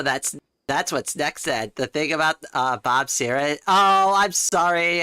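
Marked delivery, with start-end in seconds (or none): 3.7-5.0 a long, high, loud drawn-out "Oh"; startled oh. Real, not acted. Streamer caught misquoting chat, startled apology.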